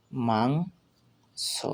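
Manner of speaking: মাংস very slow, rising through mang; pronunciation demo. Shown as a demonstration. Teacher joins the letters for learners, মাংস drawn out. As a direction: hold mang long, then a separate so in মাংস. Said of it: "এর উচ্চারণ কিভাবে করতে হয়".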